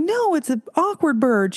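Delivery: high, insistent denial; mock-huffy high retort; indignant protest. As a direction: push back hard. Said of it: "And she was like".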